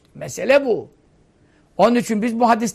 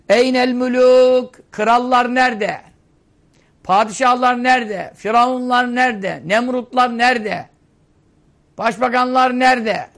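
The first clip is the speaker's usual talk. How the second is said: preaching at full force; 3 semitones higher, 4 dB louder than usual. Acted. Preacher voicing God asking where the kings have gone, booming. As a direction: thunder each question out to the congregation.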